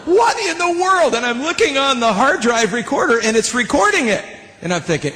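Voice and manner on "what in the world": high, drawn-out amazed exclamation; astonished. Retold or imitated. Musician baffled by harmonies in the room, high astonishment. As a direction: stretch it out in disbelief, pitched way up.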